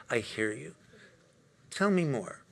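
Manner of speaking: soft, caring listening voice; sincere. Shown as a demonstration. Listener to someone they are close with, softly sincere. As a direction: say it gently, drawing them out.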